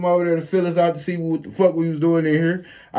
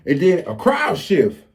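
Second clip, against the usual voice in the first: usual speaking voice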